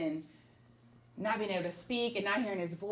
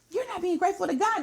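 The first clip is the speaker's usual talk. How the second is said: higher, louder mock scolding of husband; imitating upset scolding; 10 semitones higher, 5 dB louder than usual. Wife retelling how she scolded her husband, imitating upset. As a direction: replay the scolding with raised pitch. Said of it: "I was so upset with him".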